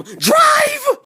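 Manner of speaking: loud call rising to a high scream; yell. Retold or imitated. Teller diving into the van to flee, screaming. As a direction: yell it, exploding on "drive". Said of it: "and I yelled".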